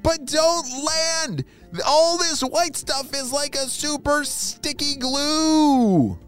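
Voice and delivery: high-pitched, drawn-out mock yell; calling a warning. Stuck spy warning the rescuers overhead, high stretched call.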